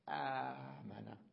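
آمنة with a very drawn-out first aa; stretched long vowel. Shown as a demonstration. Teacher showing how the alif is born, vowel stretched. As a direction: hold the opening aa of آمنة long. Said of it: "ثم أمد".

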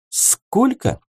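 nearly choking at the price; shocked. A narrator's reading. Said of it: "Парень чуть не подавился".

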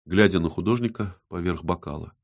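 usual speaking voice

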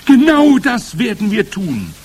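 high, loud eager screech; shriek. A narrator's reading. Witches agreeing with their leader, shrieking. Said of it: "kreischten die Hexen".

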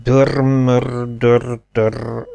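tongue-tied, stuck on rolled r's; stammering. A narrator's reading. Captain attempting a hard Hungarian name, stammering. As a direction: stumble through the name, droning each syllable. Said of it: "hebegte a kapitány".

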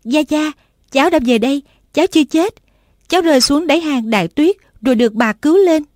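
lightly voiced girl crying out to her grandpa; granddaughter calling out. A narrator's reading. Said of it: "A Tú kêu lên".

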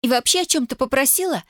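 high-pitched indignant questions; aghast disbelief. A narrator's reading. Tatiana denies she would ever ask him, aghast. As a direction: let the pitch climb in outraged disbelief. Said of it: "ахнула Татьяна".

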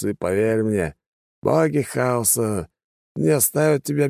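slurred, halting tipsy mentor voice; drunken old teacher. A narrator's reading. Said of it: "его слегка заплетающийся и сбивчивый голос".

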